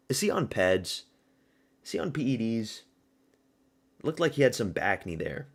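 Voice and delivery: ordinary talk, nothing marked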